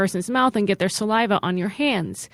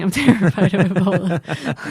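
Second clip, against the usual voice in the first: laughing confession. Real, not acted. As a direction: laugh breaking into "terrified". Repeats her confession while laughing.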